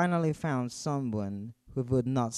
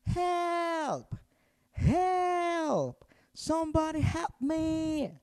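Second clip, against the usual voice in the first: very high frightened cries; little mouse crying for help from the cat; slower than usual. Acted. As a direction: scream for help, terrified. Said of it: "they heard cries".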